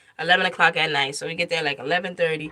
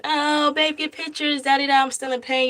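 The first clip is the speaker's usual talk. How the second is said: sweet and cooing; 9 semitones higher than usual. Retold or imitated. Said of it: "first it was all sweet".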